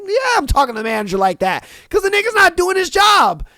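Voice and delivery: building to a high shout; defiant shout. Retold or imitated. Worker defiantly yells at people shocked he talks back to the manager. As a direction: go from fast and defiant to yelling. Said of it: "I'm like".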